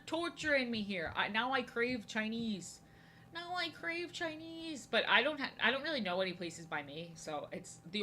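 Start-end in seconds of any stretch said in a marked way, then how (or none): 3.3-4.9 high whiny drawl. Pretend. Whiny repeat of craving.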